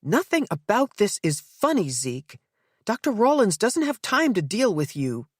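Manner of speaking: high, sharp fury at her husband; furious. Acted. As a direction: snap at him with rising anger. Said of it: "her voice now powered by a note of fury".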